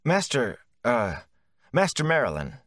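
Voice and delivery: unsure. A narrator's reading. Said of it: "unsure exactly what form of address".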